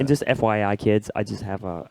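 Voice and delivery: ordinary talk, nothing marked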